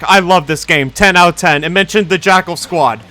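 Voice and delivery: loud, gushing fan hype; thrilled fan taking it all back; excited outburst. Real, not acted. Delighted by a favourite mention.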